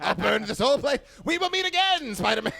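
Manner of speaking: high loud hammed-up villain threat; supervillain rant. Vowing revenge on Spider-Man, hammed-up villain shout. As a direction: ham it up like a cartoon villain. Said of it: "supervillain and be like".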